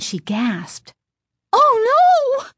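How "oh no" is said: very high, loud shocked cry; startled cry. A narrator's reading. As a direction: cry out in alarm. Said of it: "The startled cry escaped her lips".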